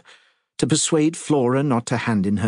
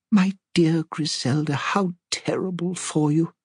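put-on old lady; 8 semitones higher than usual. A narrator's reading. Old lady voiced in story.

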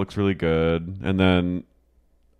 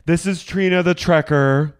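mock TV host voice; 8 semitones higher, 5 dB louder, slower than usual. [name] introduces her character.

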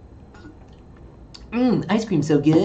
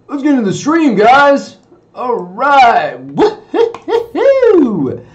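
hyped up; 5 semitones higher, 4 dB louder, slower than usual. Real, not acted. Streamer rallies guys to get into the stream, hollering. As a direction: build to a high, drawn-out holler.

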